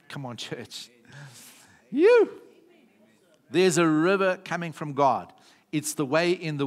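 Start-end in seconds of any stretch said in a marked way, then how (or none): 1.9-2.3 sudden high, loud "You."; preacher's sudden shout; shouted call. Real, not acted. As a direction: fling the word at the congregation. Preacher calls out to the church.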